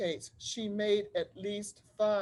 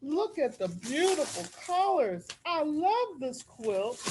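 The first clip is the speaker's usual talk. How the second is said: fond delight; 6 semitones higher than usual. Real, not acted. Teacher unwraps friend's quilt.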